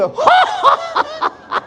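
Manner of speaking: high, hooting mock laugh. Imitating his closest friend laughing at the pitch, mocking laugh. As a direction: imitate someone laughing uncontrollably at you. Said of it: "Have you ever had anybody go".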